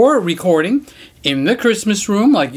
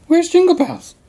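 pet talk; 12 semitones higher, 3 dB louder than usual. Real, not acted. Looking for Jingle Bells, high sing-song pet talk. Cooing to the dog.

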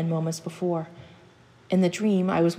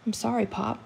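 put-on small, higher-pitched child's apology; timid boy; 5 semitones higher, 3 dB quieter than usual. Frightened boy apologizing to his father, timid. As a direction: apologize meekly in a raised child's voice.

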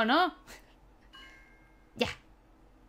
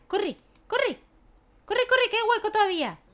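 much higher, urgent corre corre; urgent cheering; 9 semitones higher than usual. Real, not acted. Player urging her character to run for a spot.

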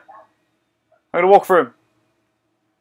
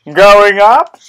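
higher, louder rising going up; excited; 5 semitones higher, 10 dB louder than usual. Shown as a demonstration. Gamer announcing an elevator ride, excitedly. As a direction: announce the rise with glee. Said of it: "repeated louder higher".

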